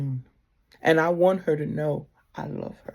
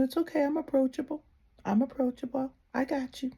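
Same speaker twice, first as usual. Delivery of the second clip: lightly voiced spirit, higher and softer; a soft, reassuring spirit's reply; Chango answers gently; 7 semitones higher, 6 dB quieter, faster than usual. The Orisha Chango reassuring a nervous devotee, softly higher. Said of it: "And he let me know".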